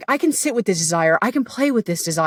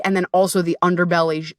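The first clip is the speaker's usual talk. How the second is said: normal talking voice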